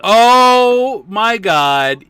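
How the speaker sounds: high, loud, drawn-out gasp of delight; excited squeal. Real, not acted. Excited squeal over a character.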